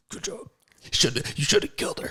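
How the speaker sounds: soft, breathy mock coach aside from the sideline; coach's aside. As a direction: coach quietly praising him from the sideline, hushed. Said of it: "He's like".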